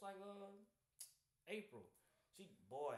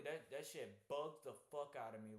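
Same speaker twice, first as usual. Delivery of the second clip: ordinary talk, nothing marked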